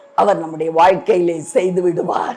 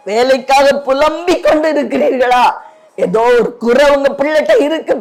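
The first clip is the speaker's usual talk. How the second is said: chanted questions climbing in pitch; urgent preaching; 5 semitones higher than usual. Real, not acted. Preacher asking congregation about their laments, urgent and climbing. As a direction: build question on question, rising and relentless.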